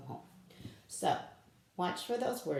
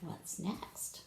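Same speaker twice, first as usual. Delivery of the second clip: whispering; 8 dB quieter than usual. Acted. Hushed question.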